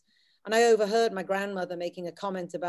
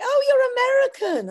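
imitating people back home, much higher and louder; mock accusing; 12 dB louder than usual. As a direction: squeal it out like a shocked relative. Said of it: "people are like".

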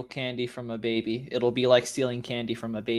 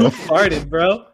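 loud outburst; 4 semitones higher, 10 dB louder, much slower than usual. Real, not acted. Friends react to a fart.

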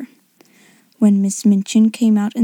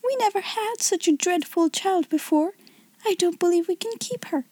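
high, soft, plaintive complaint; high-pitched fretful whimper; almost whimpering; 7 semitones higher, 5 dB quieter than usual. A narrator's reading. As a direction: lament helplessly, close to whimpering. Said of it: "almost whimpered Miss Amelia".

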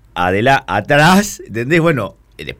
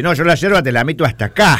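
imitating a friend; 7 semitones higher, faster than usual. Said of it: "nada, dice".

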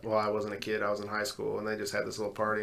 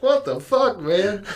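high, loud disbelieving cry; high, loud cry of disbelief at careless parents; mock outraged shout; 11 semitones higher, 8 dB louder, slower than usual. Reacting to parents' answer.

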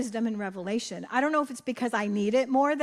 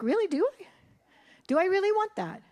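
higher, gently teasing; ironic question; 7 semitones higher than usual. Acted. God teasing her about what He wants, much higher. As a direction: question her like a wry parent. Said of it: "He's like".

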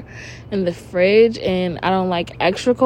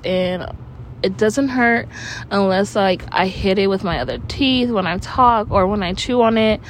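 ordinary delivery

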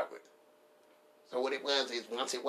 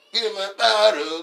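high, loud club brag; loud boast; 11 semitones higher, 12 dB louder than usual. Retold or imitated. Bragging in the club mirror, high loud boast. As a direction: shout it like a flex.

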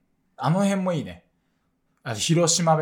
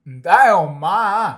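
exaggerated Ibaraki intonation veering into Korean; loud botched dialect, accidentally Korean-sounding; 3 semitones higher, 10 dB louder than usual. Shown as a demonstration. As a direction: punch it loudly with a Korean-like tune. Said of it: "韓国じゃん".

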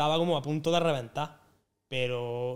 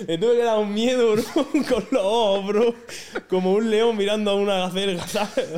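laughing loudly through the telling; 5 semitones higher, 7 dB louder than usual. Real, not acted. To his co-hosts, recalling scaring the girl, laughing loudly. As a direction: crack up while recalling it.

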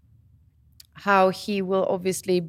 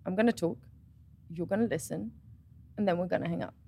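much softer, calm and firm; firm quiet dad; 10 dB quieter, faster than usual. Retold or imitated. Father stopping his daughter on FaceTime, softly and firmly. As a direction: lay down the rules quietly. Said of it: "he's like".

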